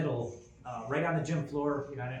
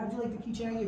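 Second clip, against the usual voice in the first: put-on much higher, friendly kid-directed tone; upbeat question; 10 semitones higher, much faster than usual. Dad asking the boy about his gift, upbeat high tone. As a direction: talk up to a small child. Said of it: "I was like".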